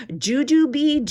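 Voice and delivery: ordinary delivery, nothing marked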